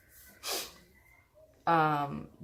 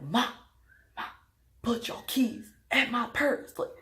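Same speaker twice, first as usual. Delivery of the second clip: urgent plea; 6 semitones higher than usual. Retold or imitated. Urging mom to hide keys.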